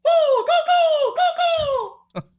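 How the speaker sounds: high, loud cheer urging the ball on; excited cheering. Real, not acted. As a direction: yell go at the ball.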